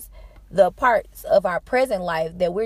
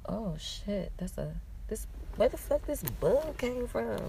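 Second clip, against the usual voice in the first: startled hush; 3 semitones higher, 6 dB quieter, slower than usual. Real, not acted. Bug appears mid-sentence, real reaction.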